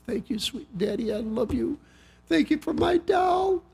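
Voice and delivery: lightly voiced happy little girl; soft little girl. Happy daughter thanks her daddy.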